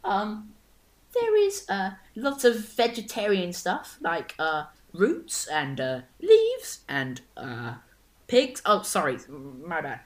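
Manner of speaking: put-on lightly squeaky teen server; a hint of a cracking boy voice. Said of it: "voice hasn't quite settled yet".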